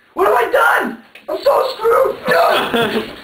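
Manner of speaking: high, loud, desperate panic; panicked victim. Acted. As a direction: cry out like you're caught. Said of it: "our actors emulating these nefarious, uh, thought police in a brief reenactment".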